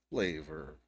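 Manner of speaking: a low, dry flavor, doubting it; low deadpan repeat. Retold or imitated. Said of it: "she called it flavor".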